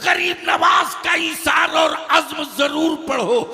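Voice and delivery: loud, high, ringing sermon delivery; full-force preaching. Real, not acted. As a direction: preach it out to a big crowd. (पूरे जोश में तक़रीर)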